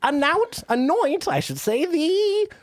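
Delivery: high, slow ceremonial proclamation; grand, high-pitched monarch intoning; Queen of England. Acted. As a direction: knight a subject in a drawn-out British royal voice. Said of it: "Back in character".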